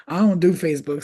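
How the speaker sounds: ordinary delivery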